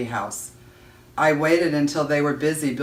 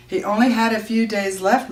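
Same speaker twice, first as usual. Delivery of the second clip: a touch of sobbing, pitched higher; 7 semitones higher, 4 dB louder than usual. Acted. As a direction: voice rising in distress, lightly sobbed. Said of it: "my mother sobbed".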